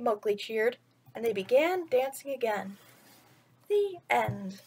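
put-on gentle storyteller voice reading to children; bedtime story reading. A narrator's reading. Vlogger reading a picture book to kids, storytelling voice.

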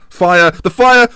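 loud, high call to a player; urgent shout. Real, not acted. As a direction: yell the nickname as play breaks. Urgent call during live play.

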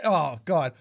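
ordinary talk, nothing marked

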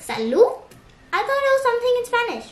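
doubtful question, then quick high protest; puzzled viewer. Acted. Imagined viewer doubting the word, puzzled. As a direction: voice a confused pupil objecting. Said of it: "And you'll be like".

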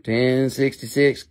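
normal talking voice

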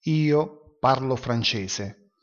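ordinary talk

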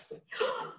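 sharp gasp. Acted. Finds a forgotten keepsake.

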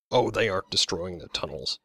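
usual speaking voice

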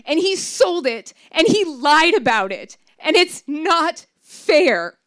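upset wail of protest. Retold or imitated. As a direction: cry it out, protesting. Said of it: "I wailed".

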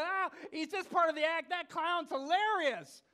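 loud, high mock crowd reaction; amused audience. Audience takes the warning as part of the act, loud and amused. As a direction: imitate a delighted crowd at full volume. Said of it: "the audience laughed it and erupted".

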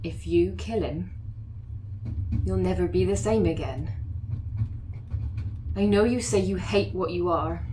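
normal talking voice

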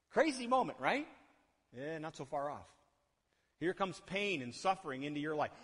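ordinary talk

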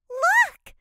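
high, loud excited shout. A narrator's reading. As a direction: call out to your sister. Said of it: "shouted Emma".

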